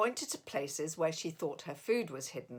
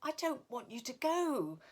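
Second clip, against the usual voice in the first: higher, sulky little voice; 5 semitones higher, 3 dB quieter than usual. A narrator's reading. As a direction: pout it out like a sad child. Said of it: "sulked Figgy Two Socks".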